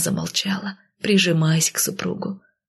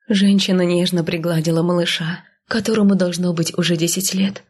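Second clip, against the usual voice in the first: ordinary delivery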